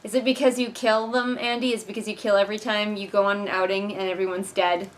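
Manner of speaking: ordinary delivery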